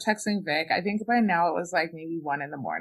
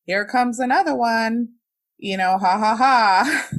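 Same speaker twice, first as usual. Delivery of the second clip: imitating a mocking screener, louder and higher; mocking joke; 5 semitones higher, 8 dB louder than usual. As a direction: tease them with a fake ha ha ha. Guy at front mocking her.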